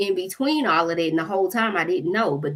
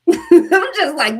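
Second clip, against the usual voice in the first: laughing through the words, slow and loud; 11 semitones higher, 9 dB louder, much slower than usual. Real, not acted. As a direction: burst out laughing as you say it. Laughing at absurd arrest situation.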